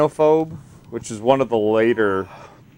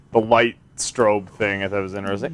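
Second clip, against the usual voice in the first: ordinary talk, nothing marked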